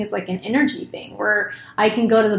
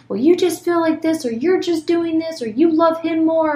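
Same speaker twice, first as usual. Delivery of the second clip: mock whiny kid; 8 semitones higher than usual. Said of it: "and then we're like".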